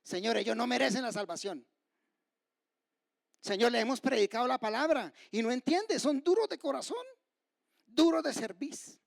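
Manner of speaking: indignant plea building high; mock accusing prayer. Preacher imagines Stephen condemning his hearers, mock indignant outcry. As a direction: complain to God, rising in outrage. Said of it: "Eso les dijo, ¿cierto?".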